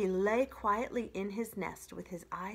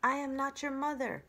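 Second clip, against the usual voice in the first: lightly voiced robot, a touch higher; even, measured robot line; a hint of a calm robot; 6 semitones higher, faster than usual. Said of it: "said the robot".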